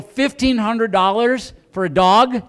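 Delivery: loud, slow, rising disbelief at the price; a husband's appalled outcry over fifteen hundred dollars; shocked disbelief. Retold or imitated. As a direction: balk at the cost, drawn out. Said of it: "I go".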